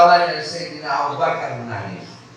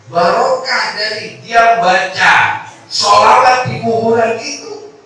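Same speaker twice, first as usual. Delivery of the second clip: preaching emphasis; 9 semitones higher, 9 dB louder than usual. Acted.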